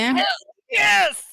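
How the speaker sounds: high, loud whoop of victory; real burst of excited cheering; triumphant shout. Real, not acted. Cheers successful roll.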